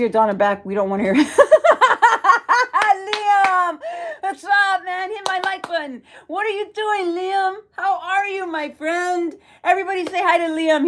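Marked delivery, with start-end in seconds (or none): 1.3-4.1 a burst of high, loud laughter; bright high laugh. Real, not acted. Laughs greeting live chat viewer. 4.2-5.1 high-pitched, delighted hello; excited greeting. Real, not acted. Greets viewer Liam in chat. 5.1-10.8 high, bubbly chatter to the viewers; excited shout-outs. Real, not acted. Greeting chat viewers excitedly.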